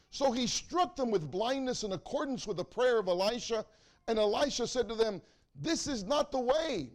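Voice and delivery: loud, ringing pulpit preaching that climbs as the story builds; preaching at full force. Acted. Preacher telling his church the Elisha story, loud and full. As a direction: preach it out big to the congregation.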